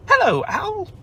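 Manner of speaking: very high little joey voice; Roo's squeaky greeting. Acted. Roo greeting Owl in a high squeak. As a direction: chirp it like a tiny kangaroo.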